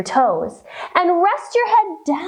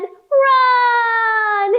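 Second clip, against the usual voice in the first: mouse cry; 9 semitones higher than usual. Acted. Mouse voice in rhyme.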